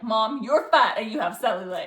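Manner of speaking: loud pretend jab at mom; hammed-up blunt daughter; mock toxic retort.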